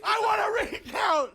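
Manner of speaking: high, loud, insistent repeat; a shouted recount demand; firm demand. Real, not acted. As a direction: demand it as if it's final.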